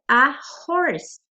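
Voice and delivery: "horse" slow, with an audible H; H pronounced. Shown as a demonstration. Teacher contrasting with hour, "horse" said with audible H. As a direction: sound the H at the start of "horse", slowly. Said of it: "la H sí se pronuncia".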